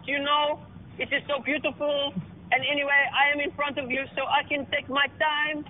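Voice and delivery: a preening Frenchman mocking a rival in his accent; mock French boast. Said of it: "And then he goes".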